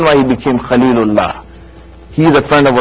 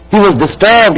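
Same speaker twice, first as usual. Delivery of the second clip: "He was disturbed" said again, higher and pressed harder; more forceful repeat; 6 semitones higher, 3 dB louder than usual. Shown as a demonstration. Preacher repeating the line to the congregation, more forceful. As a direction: lean into "disturbed" the second time.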